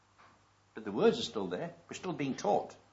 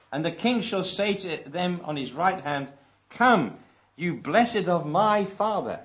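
loud, slow proclaiming; preacher voicing the king's welcome, slow and loud; preaching scripture; 3 semitones higher, 8 dB louder, slower than usual. Preacher reads the king's words.

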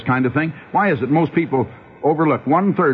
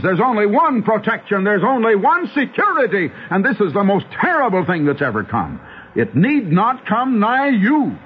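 raised, urgent pulpit warning, higher pitch; fervent preaching; 8 semitones higher than usual. Acted. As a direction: plead with the flock to escape.

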